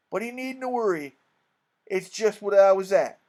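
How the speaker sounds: loud, drawn-up folksy speechifying; pious con-man King. Acted. King excusing poor William to the mourners, pious and loud. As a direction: play the smooth old fraud covering for himself. The King explains away the note.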